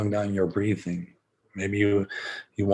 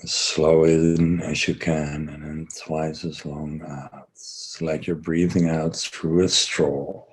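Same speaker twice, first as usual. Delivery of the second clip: guided breathing; 5 semitones lower than usual. Acted. Guides slow breathing session.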